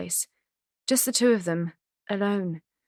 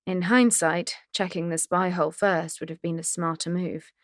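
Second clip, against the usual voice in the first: ordinary delivery, nothing marked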